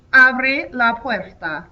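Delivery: slow, careful Spanish with a rolled r in "puerta"; slow Spanish demo. Shown as a demonstration. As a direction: model "Abre la puerta" slowly for the class. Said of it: "The command will be".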